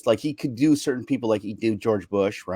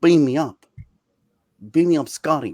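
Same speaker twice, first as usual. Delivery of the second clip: higher, louder Kirk phrasing; higher, louder Captain Kirk line; Kirk line practice; 4 semitones higher, 3 dB louder than usual. Shown as a demonstration. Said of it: "just say any sentence".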